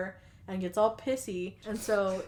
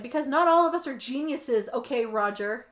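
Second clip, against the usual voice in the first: loud, higher-pitched mock snapping back; imitating someone fed up and sarcastic; exasperated retort; 4 semitones higher, 8 dB louder than usual. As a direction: act out a defensive comeback. Acts out snapping back in argument.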